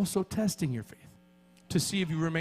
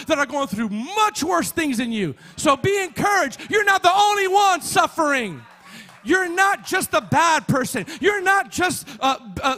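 loud, high-pitched exhortation; rally the congregation with raised, urgent encouragement; impassioned pulpit shout; full-force preaching; 10 semitones higher, 7 dB louder than usual. Real, not acted.

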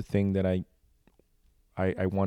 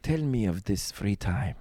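village elder. Pretend. Mock ancient sage voice.

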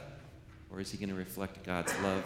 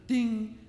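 a much higher, louder "Ding" like a bell; 12 semitones higher, 5 dB louder than usual. Pretend. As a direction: ring it out like a game-show bell. Game buzzer for the kids.

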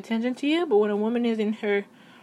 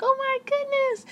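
put-on much higher, slow cooing baby voice. Woman crushing on a guy, much higher cooing baby voice. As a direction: gush like a smitten girl to a guy. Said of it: "talk in that baby voice".

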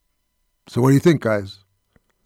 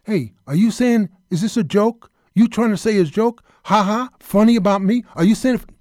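hammed-up De Niro tough guy; 6 semitones higher, 3 dB louder than usual. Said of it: "this is my best line".

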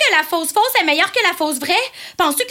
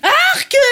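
high cry of disgust; disgusted yuck; 9 semitones higher, 3 dB louder than usual. Acted. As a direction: recoil with a sharp "yuck". Revolted by what she pictures. (cri de dégoût aigu)

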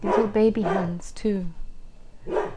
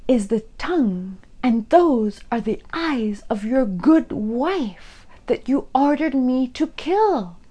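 loud, higher, slowing accusation; yelling; 4 semitones higher, 9 dB louder, slower than usual. A narrator's reading. Mother yells at her son about his wife. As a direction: shout it in his face. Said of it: "she yelled his mother".

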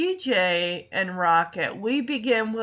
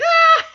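high, loud mock shriek breaking into a laugh; Joey bursting in, high laughing shriek; startled laughing scream; 12 dB louder than usual. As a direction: yelp like Joey catching sight of Danny's outfit. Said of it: "and he's like".

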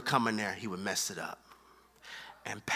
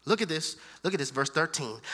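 ordinary delivery

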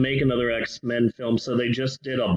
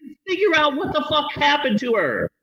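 laughing exasperated rant; 11 semitones higher than usual. Acted. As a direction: yell at her through laughter. Ranting at character, laughing.

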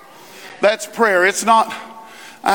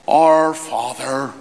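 a lower, slow, churchy intoning of the prayer; solemn prayer voice; 8 semitones lower, much slower than usual. Retold or imitated. Friend leading ballgame prayer, slow solemn intoning. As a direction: put on the formal seminary prayer tone. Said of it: "in a certain tone, in certain voices".

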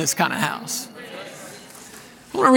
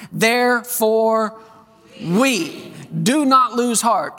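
slow, deliberate reading of the verse; scripture reading; 2 semitones higher, much slower than usual. Acted. Preacher reading Corinthians aloud to the congregation, slow and deliberate. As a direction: read it slowly, weighing each phrase.

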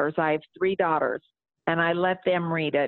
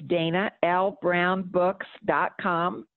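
slow, careful website address; spelled out slowly; slower than usual. Shown as a demonstration. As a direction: give each part of the web address room. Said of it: "I have a website".

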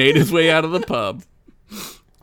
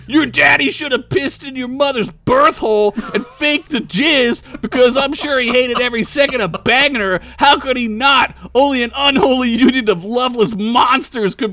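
higher, louder furious tirade; old orc yelling; 9 semitones higher, 4 dB louder than usual. Acted. Old orc screaming insults at the bard, furious yell. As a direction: roast him at full volume. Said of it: "yelled a really old orc".

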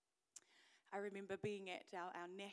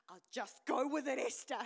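urging herself; 3 semitones higher, 12 dB louder than usual. Retold or imitated. Voicing her urging to herself.

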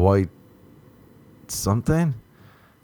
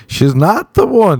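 higher, louder and slow; brain screaming; 9 semitones higher, 5 dB louder than usual. Pretend. His brain yelling at him in bed, slow loud yell. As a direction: yell it like an alarm in your head. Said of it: "my brain doesn't start screaming".

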